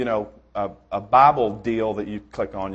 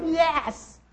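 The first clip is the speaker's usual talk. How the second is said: put-on high, delighted kid's cheer; squeaky whoop of joy; gleeful kid yell. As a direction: shout it like a thrilled little boy. Optimist kid celebrates in poo.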